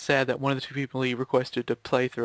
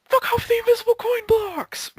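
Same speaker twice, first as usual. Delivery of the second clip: very high, loud yell into laughter; furious yell; 5 dB louder than usual. Real, not acted. Gamer yells at invisible coin blocks, very high. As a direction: scream it at the game. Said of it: "I have but one thing to say".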